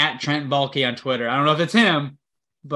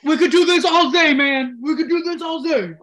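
high, loud, laughing hype voice; hyped-up shout; 11 semitones higher, 4 dB louder than usual. Pretend. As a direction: yell it up high like a pumped-up player. Hyping up coach, all Zay play.

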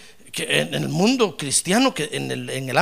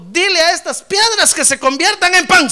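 mock devil; 10 semitones higher, 7 dB louder than usual. Devil quoted.

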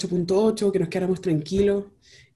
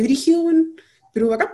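sudden high exclamation; emocionada; 9 semitones higher, 5 dB louder than usual. Real, not acted. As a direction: burst out in excitement, much higher. (exclamación de asombro, mucho más alta)

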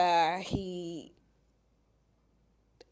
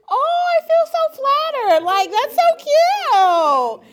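much higher, louder, slow, gushing sarcasm; mock flattered; 12 dB louder, slower than usual. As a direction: fake swoon, dragging it out. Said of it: "And I was like".

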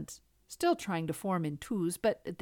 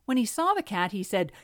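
ordinary delivery

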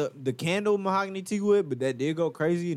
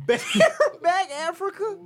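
laughing disbelief; 12 semitones higher, 3 dB louder, slower than usual. Real, not acted. Laughing at friend's odd phrase.

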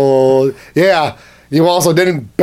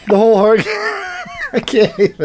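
laughing. Real, not acted. Can't stop laughing.

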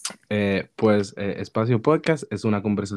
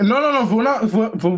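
excited; 10 semitones higher, 5 dB louder than usual. Real, not acted. Sudden excited protest.

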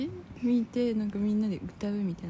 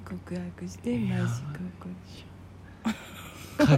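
whisper; 3 semitones lower, slower than usual. Real, not acted. Whispered aside about confessing.

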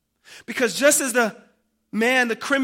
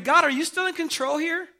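higher, louder questions rising in disbelief; incredulous wondering; 6 semitones higher, 4 dB louder than usual. Acted. A preacher voices doubters watching the story unfold, incredulous. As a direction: question God in raised, bewildered disbelief. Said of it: "you get the sense of wondering".

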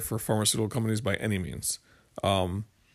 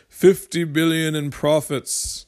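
bad imitation of the senator's accent, louder and higher; slow, loud, badly faked politician's accent; Bernie Sanders; 5 semitones higher, 5 dB louder, slower than usual. Host mimicking the senator on drug profits, badly faked accent. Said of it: "That's my worst Bernie Sanders acc".

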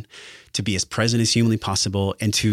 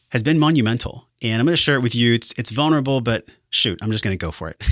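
ordinary talk

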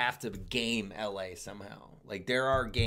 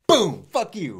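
imitating KJ roasting; 5 semitones higher, 10 dB louder, slower than usual. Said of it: "start talking shit".

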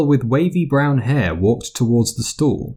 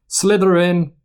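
high, ringing announcement of the house; Sorting Hat call; 8 semitones higher than usual. A narrator's reading. The hat calling out the new student's house.

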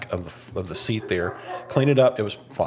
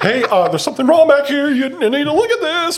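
very high, louder mock dog voice; dog's voice; 6 dB louder than usual. Husband voicing the dog pointing at the mess. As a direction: squeak it like a panicked dog. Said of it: "she's looking at something like".

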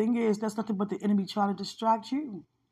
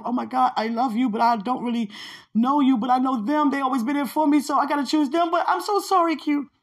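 imitated pleading, climbing higher and louder; mock pleading; 5 semitones higher, 6 dB louder than usual. Someone choosing friends over the speaker, mock pleading. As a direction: build to a high, loud, hammed-up apology.

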